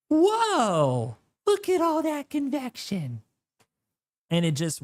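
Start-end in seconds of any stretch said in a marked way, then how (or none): 0.1-3.2 voicing the model. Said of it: "and it's like".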